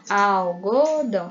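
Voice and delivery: slow "algodão", al... go... dão; "algodão" in three drawn-out beats; split into syllables. Shown as a demonstration. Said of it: "palavras em sílabas".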